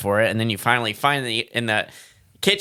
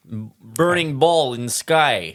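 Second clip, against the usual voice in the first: slow, heavy mock Russian accent; broken English in a put-on Russian accent; 2 semitones higher, much slower than usual. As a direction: drag it out like a hammed-up Russian. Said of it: "read it in a R-, Russian accent".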